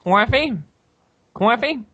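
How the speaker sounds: high mock New York "Coffee?" as "cwoffee"; teasing high New York accent. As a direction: ham up a New York "cawfee". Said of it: "why don't you say coffee".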